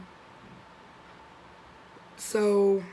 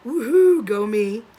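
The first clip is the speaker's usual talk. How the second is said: sarcastic cheer; 2 semitones higher, 9 dB louder than usual. Acted. Sarcastic cheer about turning thirty-two.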